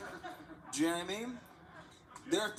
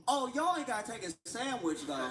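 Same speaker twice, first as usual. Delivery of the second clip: amused bystander; 8 semitones higher than usual. Retold or imitated. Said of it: "the person filming goes".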